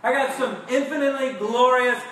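ordinary talk

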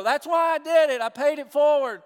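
loud, high, smug churchgoer pointing at his logo; smug showoff. Retold or imitated. Mock proud churchgoer at McDonald's.

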